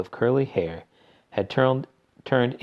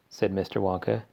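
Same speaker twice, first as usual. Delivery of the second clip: plain reading voice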